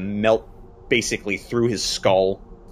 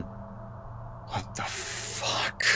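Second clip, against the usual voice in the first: very slow, voiceless whisper of shock; stunned whisper; 13 dB quieter, much slower than usual. Acted. Game master as the sergeant after the shooting, whispering in shock. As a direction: drag it out in a horrified whisper.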